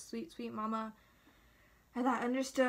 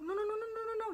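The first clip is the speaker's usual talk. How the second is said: high rapid string of panicky noes; freaking out; 10 semitones higher, much faster than usual. Retold or imitated. Dad freaks out when daughter cries, flustered. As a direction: imitate a flustered parent, pitched way up. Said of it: "He freaks out when I cry".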